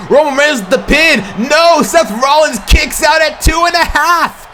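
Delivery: high, shouting play-by-play build; hyped shout. Real, not acted. Commentator calling the spear and pin, shouting high. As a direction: ride the near-fall at full voice. Near-fall after a spear.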